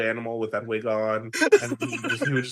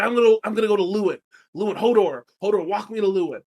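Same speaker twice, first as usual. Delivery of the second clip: hurried Bran; 6 semitones higher, 6 dB louder, faster than usual. Retold or imitated. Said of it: "he's like".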